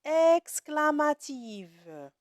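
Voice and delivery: exclamative drawn out slow and loud; drawn-out stress. Shown as a demonstration. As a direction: stretch the word exclamative. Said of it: "c'est une phrase". (mot exclamative étiré et appuyé)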